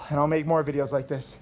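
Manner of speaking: usual speaking voice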